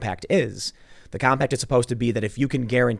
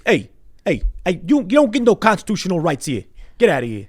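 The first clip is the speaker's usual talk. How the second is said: loud, hammed-up Jersey tough-cop accent; New Jersey accent; 8 semitones higher, 7 dB louder than usual. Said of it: "They talk more like this".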